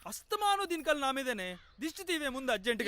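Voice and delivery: loud, high-pitched complaint; irritated outburst. Acted. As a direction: protest loudly in a rising quarrel. Quarrel, complaining about her.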